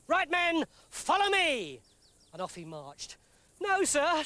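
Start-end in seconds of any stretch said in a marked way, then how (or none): 0.1-1.8 loud rallying order; commanding shout. Acted. Gideon commanding his men. 3.6-4.2 high anxious plea; pleading. Acted. Purah panicking, pleading.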